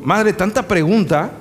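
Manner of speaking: mildly exasperated son, hitting "Madre" with a rise; irritated snap. Retold or imitated. Son snapping at his questioning mother, mildly irritated.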